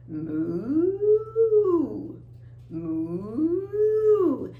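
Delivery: long moo sliding upward; cow moo. Shown as a demonstration. Teacher playing the cow sound for children, long moo. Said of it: "the cow says moo".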